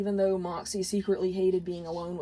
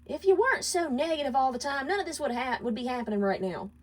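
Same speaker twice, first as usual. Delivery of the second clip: wife's higher voice, lashing out in blame; 6 semitones higher, faster than usual. Acted. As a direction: throw the blame at him. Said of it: "Millie lashed out at him".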